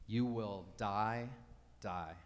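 you will die, slow, second die dropped low and soft; slow solemn repeat. Shown as a demonstration. Preacher stressing God's warning, slow and grave. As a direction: let the second die fall away low. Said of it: "using repetition in order to emphasize".

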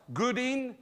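loud, fiery preaching to the congregation; full-force preaching. Acted. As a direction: thunder it out from the pulpit.

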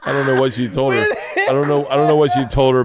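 high, loud, through laughter; laughing bafflement. Real, not acted. Storyteller baffled by what the child was told, laughing. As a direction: protest helplessly while cracking up.

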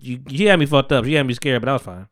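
ordinary talk, nothing marked

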